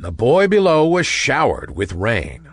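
plain reading voice

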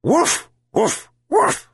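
high, short imitated woofs; yelping dog bark. A narrator's reading. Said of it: "the dog yelped".

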